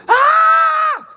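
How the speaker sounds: sudden high yelp of alarm; startled high scream cut off; sudden shout. Retold or imitated. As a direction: cry out in a sudden high shriek.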